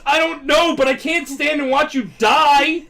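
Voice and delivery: high, loud desperate outcry; hammed-up desperate plea; desperate shout. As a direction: cry it out in acted desperation. Acts Deku's desperate reply.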